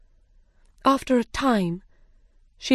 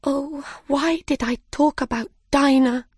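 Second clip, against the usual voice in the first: regretful cry; 7 semitones higher than usual. Acted. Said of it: "cried Alice".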